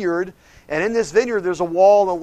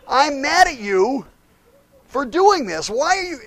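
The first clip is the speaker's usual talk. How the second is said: higher, slow, mildly indignant; much higher, drawn-out complaint; mock annoyed Balaam; 8 semitones higher than usual. Balaam scolding the talking donkey, higher mock indignation.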